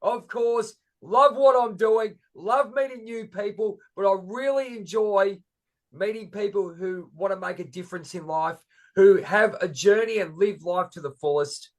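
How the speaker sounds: high, bright host energy; upbeat podcast intro. Acted. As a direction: pump up the show opener.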